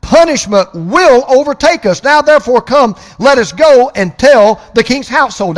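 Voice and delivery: loud, high preaching proclamation; ringing pulpit voice; fervent scripture reading. Acted. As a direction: read the verse like a herald. Preaching scripture reading at full force.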